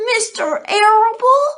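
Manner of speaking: high, loud sobbing pig voice; horrified piglet sobbing; sobbing question. A narrator's reading. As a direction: wail the name through tears. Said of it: "sobbed Wilbur".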